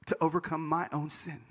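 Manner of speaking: normal talking voice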